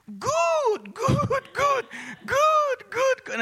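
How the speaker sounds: very high, loud whooping repetition; piercingly high exultant yells; ecstatic mother's cries. Retold or imitated. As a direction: imitate mom overcome and shouting for joy. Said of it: "this is what she says".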